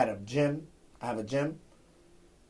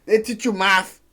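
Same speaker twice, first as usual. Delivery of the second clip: heated rant; 6 semitones higher, 8 dB louder than usual. Real, not acted. Ranting about school.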